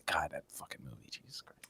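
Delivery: breathy whispered curse; whispering. Real, not acted. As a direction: whisper it, overcome. Emotional about the movie.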